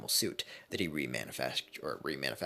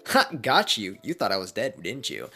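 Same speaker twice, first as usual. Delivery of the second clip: louder, higher cocky gloat; gloating taunt; 7 semitones higher, 7 dB louder than usual. Acted. Deadpool revived, taunting the shocked class loudly. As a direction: rub it in their faces.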